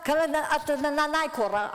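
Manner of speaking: high, pleading retold call. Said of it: "So I'm saying".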